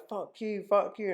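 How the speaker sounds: high-pitched imitation of cursing; retold tantrum, voice pitched well up; mock angry outburst. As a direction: hurl it like an indignant customer storming off. Acted angry customer storming off.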